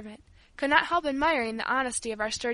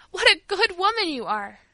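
high, loud, heartfelt thanks; burst of gratitude; 7 semitones higher, 6 dB louder than usual. A narrator's reading. A girl praises the kind old servant, bursting with gratitude. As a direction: exclaim with overflowing gratitude. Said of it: "cried Paula in a burst of gratitude".

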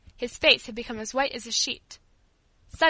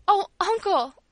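high, louder cry of appeal; acted anguished plea; imploring; 9 semitones higher, 7 dB louder, slower than usual. A narrator's reading. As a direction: beg desperately, pitched way up. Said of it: "implored Paula".

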